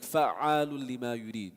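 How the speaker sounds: fa'alun lima yurid slow with a doubled ayn; recitation demo. Shown as a demonstration. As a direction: lean on the shadda in fa'alun. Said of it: "the symbol like fatha".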